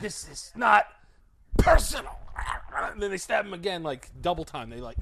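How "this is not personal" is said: movie killer line. Retold or imitated. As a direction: play a movie villain mocking his victim. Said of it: "And it's like".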